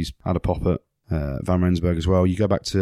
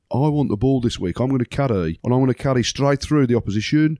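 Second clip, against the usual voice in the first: cocky Birmingham teammate, higher and pushing; Birmingham accent; 6 semitones higher than usual. Retold or imitated. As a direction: brag in a Peaky Blinders Birmingham accent. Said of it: "You sound like a Peaky Blinder".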